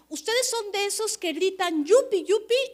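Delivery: mock yupi shout. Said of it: "le decía a su amiga".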